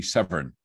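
Severn said with an R, SEV-ern; Gloucestershire Severn. Shown as a demonstration. Father showing the local Gloucestershire way to say seven. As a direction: local rhotic Severn with a burred R. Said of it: "in the local accent where I grew up would be".

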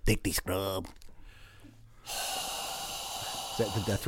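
low, slow dying rasp; death rattle. Acted. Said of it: "that's not a bad death rattle".

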